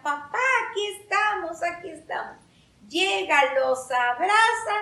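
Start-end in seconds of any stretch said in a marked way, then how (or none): 0.0-2.4 mock children's shout. Lost children answering their papá, mock shouting. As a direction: call back high, easing off on the repeat. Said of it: "y en eso le gritan".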